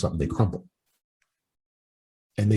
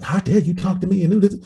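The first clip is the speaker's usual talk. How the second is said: mock outraged woman; 10 semitones higher, 4 dB louder than usual. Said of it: "the woman goes off".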